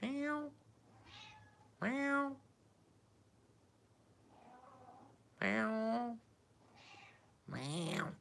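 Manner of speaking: high squeaky imitation meow to the cat. Calling back to the cat Katana, high squeaky meow. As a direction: mew up high like a kitten.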